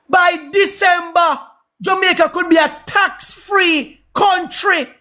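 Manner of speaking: high, slow rallying declaration; grand proclamation. Real, not acted. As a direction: stretch out each word like a campaign promise. Proclaims his grand promise.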